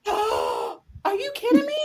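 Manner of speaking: high, gasping incredulity; mock disbelief. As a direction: react aghast, playing it up. Shocked reply to the doctor.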